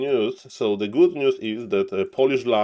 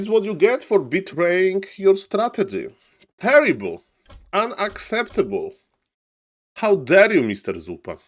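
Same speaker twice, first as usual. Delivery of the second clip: higher, hammed-up scolding; mock outrage; 6 semitones higher than usual. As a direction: pretend to be appalled at the player. Caster scolds the losing player.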